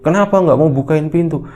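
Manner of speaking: exasperated father calling in; indignant demand. Acted. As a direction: demand an answer through the door. Father demands through closed door.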